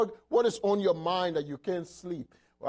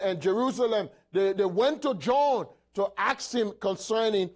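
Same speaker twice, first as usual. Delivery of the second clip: raised, emphatic preacher's delivery; impassioned preaching; 7 semitones higher, 5 dB louder than usual. Real, not acted. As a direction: drive each phrase home to the congregation.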